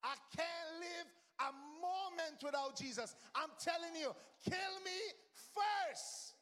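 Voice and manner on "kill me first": climactic cry. Real, not acted. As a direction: cry kill me first at the peak of the vow. Preacher's climax of repeated vow.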